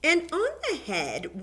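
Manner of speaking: ordinary talk, nothing marked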